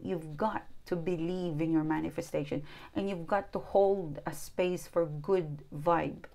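ordinary talk

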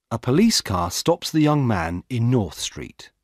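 plain reading voice

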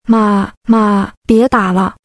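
lightly acted tearful cry of mum; understated sobby pleading; tearful plea. A narrator's reading. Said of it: "带着哭腔喊道".

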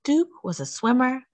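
usual speaking voice

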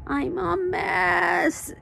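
high, very drawn-out moan; long high-pitched wail of misery. Acted. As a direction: moan it slowly in self-pity. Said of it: "Dana moaned".

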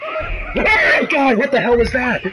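high, stretched scream of shock; jump-scare shriek into a panicked question; startled scream. Real, not acted. Startled by something in the game.

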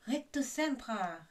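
high, drawn-out casting cry; called-out spell. A narrator's reading. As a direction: shout the spell, stretching it out. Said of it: "rief Draco".

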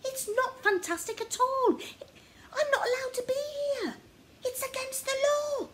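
very high, loud, near crying; on the edge of panicked tears. Acted. Oliver panicking in Poppy's house, near tears.

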